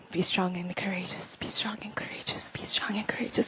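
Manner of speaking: breathy repeated whisper; soft rabbinic recitation whisper; whispered mumble. Shown as a demonstration. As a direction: murmur it over and over to yourself. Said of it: "they will just mumble".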